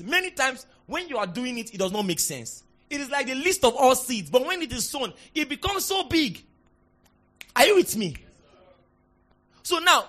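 usual speaking voice

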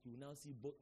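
usual speaking voice

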